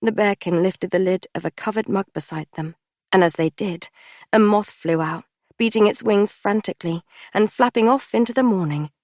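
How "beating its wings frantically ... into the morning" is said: plain reading voice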